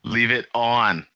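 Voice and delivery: "Leave it on" drawled slow in Boston accent. Shown as a demonstration. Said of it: "with a Boston accent".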